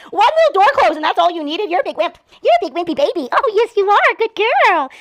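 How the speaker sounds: playful high puppy talk. Real, not acted. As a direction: coo and tease the puppy fondly. Teasing puppy affectionately.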